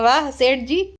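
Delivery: usual speaking voice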